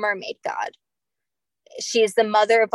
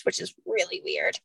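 whispered aside; 5 semitones lower, 6 dB quieter than usual. Real, not acted. Whispered aside about the documents.

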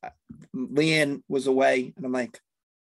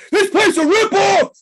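much higher, much louder screaming tirade; 11 dB louder than usual. Retold or imitated. Dad losing it at the toy store checkout, screaming. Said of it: "I just start screaming".

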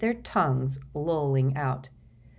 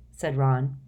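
ordinary delivery

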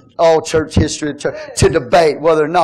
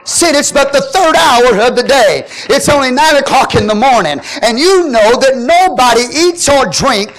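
full-force preaching; 7 semitones higher, 4 dB louder than usual. Acted. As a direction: drive the point home to the congregation.

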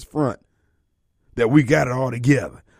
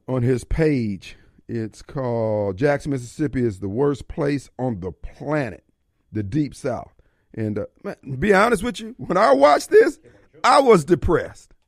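usual speaking voice

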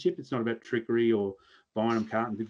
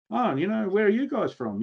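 curious country local; 8 semitones higher than usual. Retold or imitated. Locals quizzing visiting hunters.

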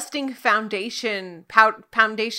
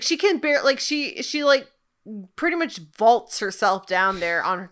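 ordinary delivery

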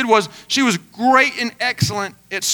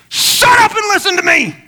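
much higher, full-force yell; acted exasperated shout; 10 semitones higher, 5 dB louder than usual. Pastor to nonstop talker on the phone, exasperated shouting. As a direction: explode after being talked over. Reenacts losing patience on the phone.

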